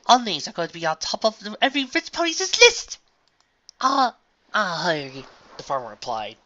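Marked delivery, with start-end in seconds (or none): 0.1-3.0 high, loud, eager lady; excited refined unicorn. Acted. 3.8-5.2 loud, halting attempt to hide a country accent; farm mare suppressing country accent. A narrator's reading. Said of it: "trying her level best not to let her speech slip".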